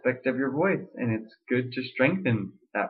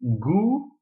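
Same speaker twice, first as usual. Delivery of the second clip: goo in falsetto; 8 semitones higher than usual. Shown as a demonstration. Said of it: "is to say".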